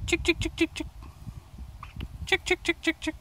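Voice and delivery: very high sing-song chick call; calling chickens. Real, not acted.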